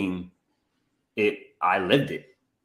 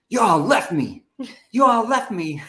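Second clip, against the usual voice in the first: higher and louder, teasing yell; mock shout; 13 semitones higher, 5 dB louder, slower than usual. The older man jokingly yelling at his family, higher and louder. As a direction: call out like you're playfully betrayed.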